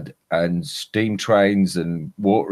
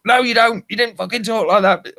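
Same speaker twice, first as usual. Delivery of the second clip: high-pitched, quick contradiction; mock indignant retort; 13 semitones higher, faster than usual. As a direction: imitate the locals arguing back, pitched way up. Acted retort in a retold argument.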